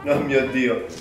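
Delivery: normal talking voice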